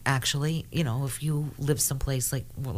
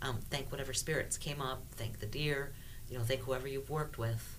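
guided meditation; 11 dB quieter than usual. Acted. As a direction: quietly lead them through the journey. Guides the listeners' spirit journey.

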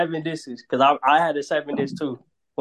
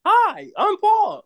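high, slow cartoon character voice; goofy cartoon guy introducing himself; exaggerated cartoon intonation; much slower than usual. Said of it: "you got me sounding like a, uh, cartoon character".

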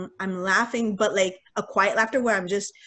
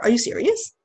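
mild shock, rising question; shocked disbelief; 3 semitones higher, 4 dB louder than usual. Retold or imitated. Niece answering her uncle's terrible news, shocked disbelief. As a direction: ask it in retold disbelief. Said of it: "I was in shock".

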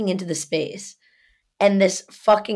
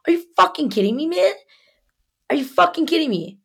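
high, incredulous challenge; re-enacted indignant outburst; retold exasperation; 10 semitones higher, faster than usual. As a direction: demand it in raised-pitch disbelief. Her side of retold quarrel.

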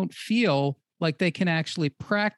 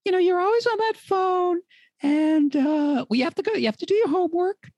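nagging mom voice; 10 semitones higher than usual. Acted. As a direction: nag the kid off the phone in a high mom voice. Mom scolding teen about phone.

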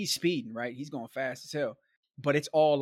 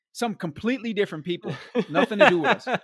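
real laugh breaking into the words, getting louder; 6 semitones higher, 7 dB louder than usual. Real, not acted. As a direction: laugh through the punchline. Laughing at the absurd mix-up.

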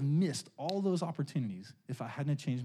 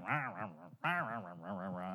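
low muttered rah-rah grumble fading softer; grumbling noise; 9 semitones lower, 8 dB quieter than usual. Retold or imitated. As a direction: growl nonsense like a driver sulking at the wheel. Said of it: "I was just kinda grumbling".

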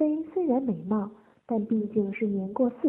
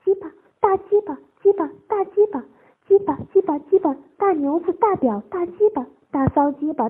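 high, loud bursts of words; worked-up high voice, short bursts; shy excitement; 5 semitones higher, 7 dB louder than usual. A narrator's reading. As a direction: call them out excitedly, pitched up. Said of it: "既害羞又兴奋".

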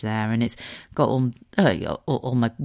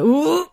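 high, loud rising "ooh"; sudden high squirm of discomfort; cringing "ooh"; 13 semitones higher, 6 dB louder than usual. Shown as a demonstration. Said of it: "like a bit of a".

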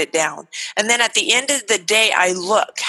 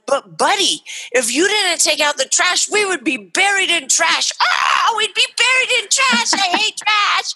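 animated silly mom; 10 semitones higher than usual. Acted. Mom clowning for her son over the trash chore. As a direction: ham it up to make the kid laugh. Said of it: "I get a little crazy".

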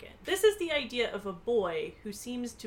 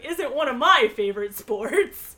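high, loud, laughing exclamation; exclaiming; 5 semitones higher, 11 dB louder than usual. A narrator's reading. Nancy jokes after bareback ox riding, loud and amused.

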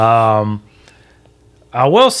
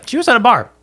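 high, loud mock outrage; mock shocked punchline; 13 semitones higher, 6 dB louder, much faster than usual. Real, not acted. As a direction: blurt it out in high-pitched disbelief. Repeats the line as a punchline.

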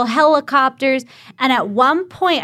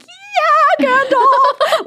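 excited fan shout; much slower than usual. Retold or imitated. Fan cheering Gandalf from the crowd, excited high yell. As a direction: squeal the name like a starstruck fan. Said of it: "Like, I was freaking out".

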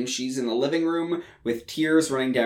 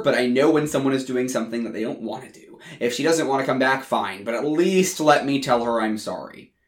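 plain reading voice

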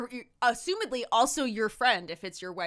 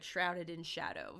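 ordinary talk, nothing marked

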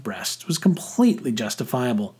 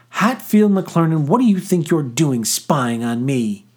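loud, high outraged outburst; indignant cry; 5 semitones higher, 4 dB louder than usual. A narrator's reading. Girl caught undressed accuses the boy, indignant cry. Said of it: "she cried".

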